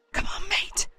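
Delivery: unvoiced hushed whisper; urgent whispered plea to a mate. A narrator's reading. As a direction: breathe it, no voice at all. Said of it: "I whispered".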